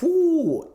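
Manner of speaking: high, loud "whoo" burst; powering-up whoosh. Shown as a demonstration. Man shows how disrespect sets him off instantly, high powering-up whoop. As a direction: whoop like a sudden power surge.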